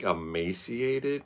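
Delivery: normal talking voice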